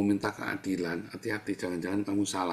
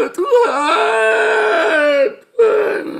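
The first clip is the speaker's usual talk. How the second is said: high, loud wail up to God; hammed-up sobbing cry to heaven; mock crying; 14 dB louder, much slower than usual. Preacher mimics someone weeping in a new BMW, exaggerated crying. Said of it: "kamu sambil nangis ya".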